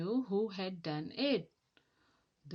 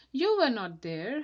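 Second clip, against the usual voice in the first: with a shiver; 3 semitones higher, 8 dB louder than usual. A narrator's reading. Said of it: "with a shiver".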